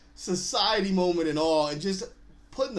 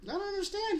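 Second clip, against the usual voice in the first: put-on puzzled kid; 14 semitones higher, 5 dB quieter than usual. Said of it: "the kid's like".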